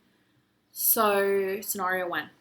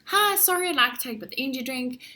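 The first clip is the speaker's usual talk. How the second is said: much higher and louder, fast; frantic rattled-off pitch; 6 semitones higher, 7 dB louder, faster than usual. Retold or imitated. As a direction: blurt the whole pitch in one breath. Worn-out salesperson rattles off pitch.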